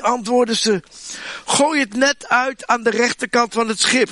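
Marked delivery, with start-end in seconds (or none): 1.5-4.1 raised call across the water. Acted. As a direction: call it out, a bit higher. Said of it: "riep Jezus". (Jezus roept naar de leerlingen, iets hoger)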